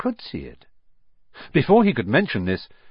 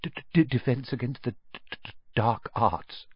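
soft stammering mutter; a nervous teacher who'd rather not talk about it, soft; stammered mutter; 6 dB quieter than usual. Acted. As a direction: mumble it nervously. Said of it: "muttered Professor Quirrell".

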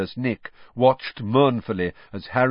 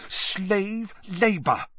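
higher, slow, bitter repetition; indignant, repeated; 12 semitones higher, much slower than usual. A narrator's reading. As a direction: hammer it home, slowly. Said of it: "breathing hard through her nose".

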